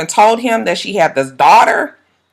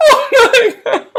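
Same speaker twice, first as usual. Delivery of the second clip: high, slow laughing gasp; laughing disbelief; slower than usual. Real, not acted. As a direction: laugh it out in shock. Laughing at the dog line.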